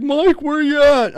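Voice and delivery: high, slow dopey call; lightly voiced dopey Ronnie; goofy coworker voice. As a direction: play the goon on the phone. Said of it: "he's like".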